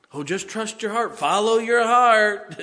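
hammed-up high, loud sing-song of naive advice; mock the worldly advice, high and loud. Preacher mocks worldly advice to the congregation, hammed-up high and loud.

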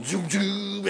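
mock organ zoom rising high; imitating organ. Preacher mimicking the church organist, playful organ zoom. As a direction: sing the organ swell as a joke. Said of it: "guy at the organ going zoom".

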